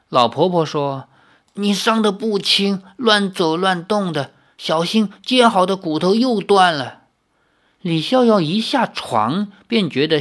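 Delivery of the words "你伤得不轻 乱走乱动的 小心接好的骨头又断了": lightly voiced caring old woman; a hint of old granny. The old woman warns the injured Xiaoyao, lightly voiced as an old granny. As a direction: gently scold like a grandmother. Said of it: "苍老的声音".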